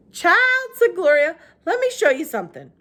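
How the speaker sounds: a hint of elderly Southern woman; old woman Gloria. A narrator's reading. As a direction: ask it like a kindly old lady. Gloria voiced by narrator.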